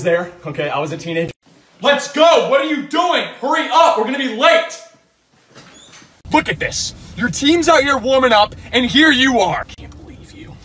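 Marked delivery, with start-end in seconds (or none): 1.8-9.6 loud, high, furious dad shouting; angry yelling. Acted. Dad scolds late son. 9.8-10.5 a disgusted whisper under the breath. Acted. Dad mutters after scolding.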